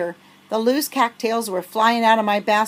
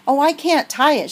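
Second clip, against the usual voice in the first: high, louder panicked cry; shriek; 6 semitones higher, 4 dB louder than usual. Acted. Girl shrieking to her friend as the farmer comes. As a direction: wail it in panic. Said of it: "she shrieked".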